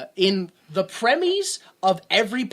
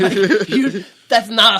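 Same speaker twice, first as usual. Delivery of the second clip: laughing; 4 semitones higher, 12 dB louder, slower than usual. Real, not acted. Laughing through a point.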